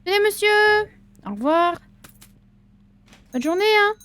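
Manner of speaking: high, loud cheery goodbye; chirpy farewell. Acted. Shopkeeper seeing off a strange customer, bright and loud. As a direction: sing it out to the customer.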